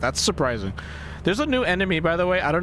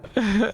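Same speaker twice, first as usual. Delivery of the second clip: laughing; 7 semitones higher than usual. Real, not acted. Laughing at wrong door mistake.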